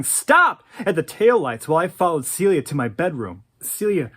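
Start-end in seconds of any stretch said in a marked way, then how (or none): 0.0-0.6 high, loud yell after a car; yelling stop. Retold or imitated. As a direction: shout it at the taillights. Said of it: "I could hear Mom yelling".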